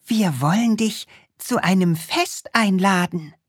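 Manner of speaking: put-on high, eager little boy voice; Krümel voice. Little Krümel inviting the witch to a party, high and eager.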